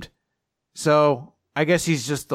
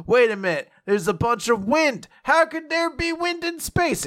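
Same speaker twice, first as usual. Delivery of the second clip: mock surprise; 11 semitones higher than usual. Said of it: "she's like".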